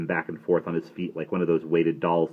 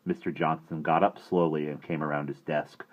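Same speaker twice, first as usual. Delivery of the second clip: ordinary delivery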